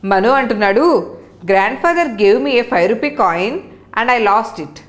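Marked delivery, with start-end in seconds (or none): 1.5-4.8 slow read-aloud. Shown as a demonstration. Teacher reads English line slowly.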